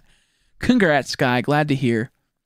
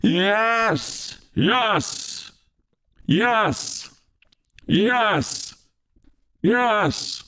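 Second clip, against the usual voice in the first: celebratory yes; 5 semitones higher, much slower than usual. Real, not acted.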